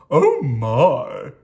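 loud alarmed cry from a storybook king; king's startled yell. A narrator's reading. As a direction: call it out in alarm, loudly. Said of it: "yelled the king".

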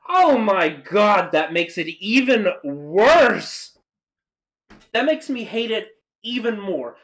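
horrified at it; outraged. Real, not acted. Outraged by the reference.

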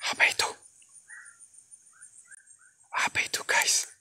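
hushed whisper, asking the viewers; frightened whisper. Real, not acted. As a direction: whisper as if afraid to be heard. Whispering at something flying.

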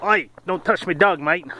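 high-pitched, hammed-up Aussie accent; mock Aussie accent. Dog owner warning off a jogger, mock Aussie accent. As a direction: imitate an Aussie telling someone off, pitched well up. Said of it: "Is that Aussie accent?".

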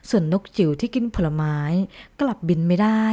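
usual speaking voice

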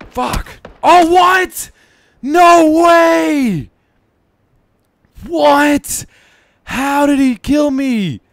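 loud, high outraged yell; raging gamer. Real, not acted. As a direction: scream your disbelief at the game. Killed in game, yelling outrage.

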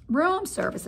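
high incredulous exclamation; can't believe he said it; exclaiming. Acted. Mother reacting to Ralph, incredulous exclamation. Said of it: "exclaimed his mother".

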